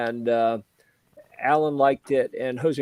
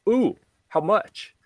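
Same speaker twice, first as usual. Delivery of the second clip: eager buyer; 8 semitones higher, slower than usual. Retold or imitated. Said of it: "he goes".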